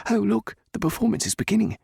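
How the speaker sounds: high, bright eager exclamation; thrilled as the show starts; excited. A narrator's reading. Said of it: "Jenna said excitedly".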